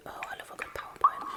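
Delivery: a soft, dry aside under the breath; sarcastic aside. Acted. Poet mocks the presentation in a soft sarcastic aside. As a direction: murmur it as a mocking side comment.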